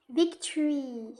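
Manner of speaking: slow, drawn-out "Victory" in a clumsy French-English accent; bad English accent. Shown as a demonstration. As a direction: stretch the English word with a weak English accent. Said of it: "L'accent anglais, c'est pas ça".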